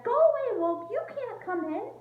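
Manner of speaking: high, loud piggy yell; yelled back. Acted. Pig yelling at the wolf through the door, high yell. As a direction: shout him off from inside. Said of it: "He yelled back".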